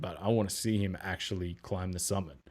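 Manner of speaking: ordinary talk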